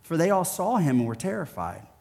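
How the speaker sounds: soft, measured Bible reading; scripture reading. Acted. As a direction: read the passage gently and solemnly. Reads scripture passage.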